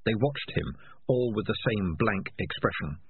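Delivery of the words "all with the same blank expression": usual speaking voice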